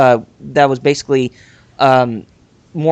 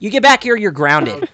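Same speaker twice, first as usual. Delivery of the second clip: joking stern dad, pitched up; mock scolding parent; 6 semitones higher than usual. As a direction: yell after him like a strict father.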